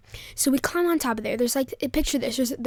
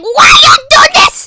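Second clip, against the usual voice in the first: very high, loud mock shriek; screamed, hammed-up protest; freaking-out scream; 17 dB louder than usual. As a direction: imitate a panicked friend caught by his mom. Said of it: "he's freaking out".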